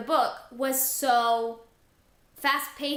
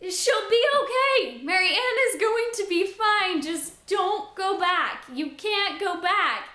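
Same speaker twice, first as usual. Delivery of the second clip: high, loud desperate cries easing into slower begging; pleading with Kath; 6 semitones higher, 4 dB louder, slower than usual. Retold or imitated. To Kath going back through the door, desperate pleading. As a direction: beg the character not to go, calming down. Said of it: "I was just like".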